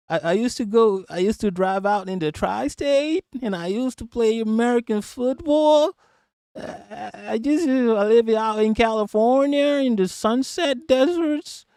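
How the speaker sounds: high-pitched mock American drawn upward; attempted vocal fry. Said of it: "I can't do the vocal".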